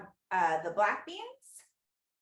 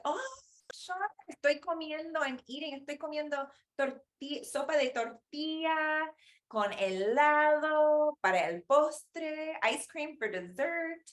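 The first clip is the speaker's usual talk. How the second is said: put-on high, sweet child voice, proud and unbothered; smug little kid; 9 semitones higher than usual. Five-year-old telling her sister what she's eating, high and sweet. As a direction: chirp it like a kid bragging about dessert. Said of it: "And I said".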